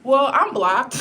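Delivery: amused laugh about getting blocked. Real, not acted. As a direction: chuckle through the bad news. Laughs about being banned again.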